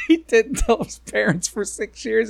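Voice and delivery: high voice breaking into laughter; laughing incredulously. Real, not acted. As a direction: barely get it out through laughter. Cracking up over the story.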